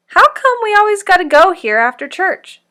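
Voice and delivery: put-on high, loud complaining kid; whiny boy. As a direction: whine like a bored child. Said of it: "C J said".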